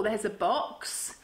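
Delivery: ordinary delivery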